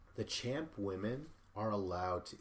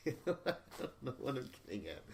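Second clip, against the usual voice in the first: laughing; 4 semitones higher than usual. Real, not acted. Laughing at own ramble.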